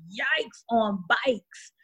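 slow, drawn-out mock cringe; wary yikes. Mocking folks nobody fucks with, slow cringe. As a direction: stretch it out like you want no part of it. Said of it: "you're like".

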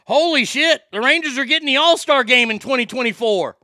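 blurting out thrilled sports news; excited. Real, not acted. Fan reading that the Rangers get the All-Star game, excited.

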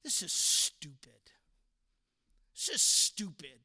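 low, sullen grumble; acted sulky student, pitched well down; bad attitude. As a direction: grumble it with heavy contempt. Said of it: "your attitude is just like".

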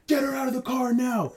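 high mock yell, kept soft; muted, strained shout; frantic order. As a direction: imitate a panicked guy giving urgent orders. Acting out man ordering at crash scene.

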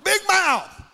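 very high, punchy crow; hammed-up high-pitched call; showman's holler. Calling out the fish's name.

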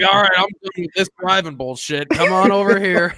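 high, loud imitation of a fed-up dad; mock lazy dad. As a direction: call the kid over with mock impatience. Dad calling son to car.

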